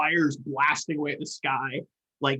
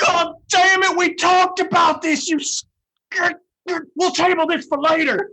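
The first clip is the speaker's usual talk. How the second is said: exasperated shout, pitched way up; furious yelling; 13 semitones higher, 8 dB louder than usual. Real, not acted. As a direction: yell at a teammate who blew it. Player furious at teammate's blunder.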